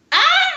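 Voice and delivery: high, loud squeal of joy; sudden high happy shriek; delighted squeal. Retold or imitated. Dress loose, delighted exclamation.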